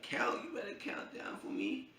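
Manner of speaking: high, breathless frightened whisper; scared whisper. Retold or imitated. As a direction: plead in a tense whisper. Scared before the piercing gun.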